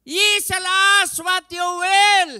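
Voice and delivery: very loud, high, slow shout; preacher shouting the verse; preaching at full force. Acted. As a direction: shout the promise. Repeated louder.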